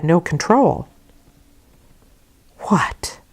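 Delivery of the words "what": unvoiced breathy whisper of shock; under her breath. A narrator's reading. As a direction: hiss it under your breath, shaken. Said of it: "said Missus Reed under her breath".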